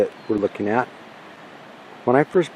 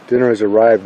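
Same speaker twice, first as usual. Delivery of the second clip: ordinary delivery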